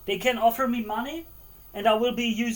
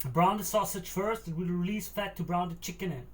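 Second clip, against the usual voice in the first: ordinary delivery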